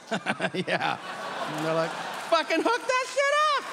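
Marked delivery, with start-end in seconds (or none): 2.3-3.6 sudden high, excited squeal; thrilled coworker cheering on the gossip; eager yell. Retold or imitated. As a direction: hype it up like great news.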